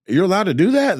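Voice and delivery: mock astonishment, pitch jumping up; retold disbelief.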